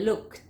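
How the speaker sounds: "looked" ending in a crisp t. Shown as a demonstration. Teacher showing past-tense endings, T sounded. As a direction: release a separate t at the end of "looked". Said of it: "Es una T".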